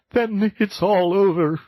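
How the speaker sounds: mock sob of despair; sobbed. Toad despairs into the sofa cushions, high wailing sob. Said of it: "sobbed the Toad".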